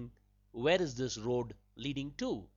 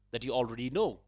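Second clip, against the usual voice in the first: ordinary delivery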